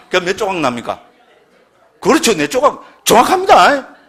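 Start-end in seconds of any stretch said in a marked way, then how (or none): 2.0-4.0 loud, high burst of approval; ringing, high-pitched confirmation; excited approval. Real, not acted. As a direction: confirm the right answer with emphatic delight. Delighted confirmation of audience's right answer.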